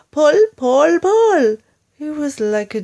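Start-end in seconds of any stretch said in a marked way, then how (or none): 0.1-1.6 high, loud repeated yells; yelling. A narrator's reading. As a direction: hammer out each pull. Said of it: "yelled Mugglewump".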